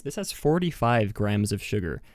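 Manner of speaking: ordinary talk, nothing marked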